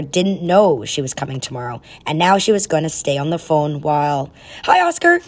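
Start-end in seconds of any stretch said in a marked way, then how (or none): 4.6-5.2 high bright greeting; brightly. Acted. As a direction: chirp it out cheerfully. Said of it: "Sage said brightly".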